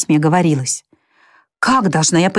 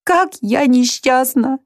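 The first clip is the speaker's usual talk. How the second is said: high, slow, grieving lament; sorrowful cry; 6 semitones higher, slower than usual. A narrator's reading. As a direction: cry it out slowly in grief. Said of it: "воскликнуть с горестью". (медленный, высокий горестный возглас)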